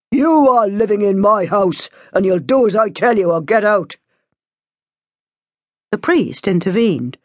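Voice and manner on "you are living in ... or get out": stern father, raised voice; firm, angry house-rule warning; angry ultimatum. A narrator's reading. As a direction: lay down the law to a defiant daughter.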